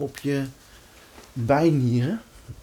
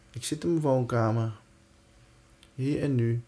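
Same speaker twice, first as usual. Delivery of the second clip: soft, reassuring meditation voice; guided meditation; 4 dB quieter than usual. Acted. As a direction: calmly guide them into safety. Guides breathing and self-talk.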